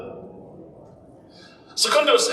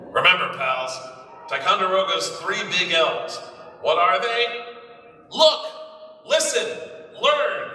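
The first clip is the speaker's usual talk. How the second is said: heroic pep talk; 6 semitones higher than usual. Acted. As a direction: cheer the children on like a matinee hero. Hero addresses schoolchildren.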